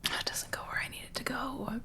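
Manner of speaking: whispered frustration. Real, not acted. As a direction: mutter it under breath. Whispering to self.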